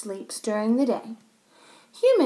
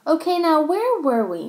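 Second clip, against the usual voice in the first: higher-pitched, mildly weary question; teacher's sigh; 6 semitones higher, 4 dB louder than usual. A narrator's reading. Teacher getting the lesson back on track, mild sigh. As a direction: sigh it as a teacher regathering the class. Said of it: "Missus Wushy sighed".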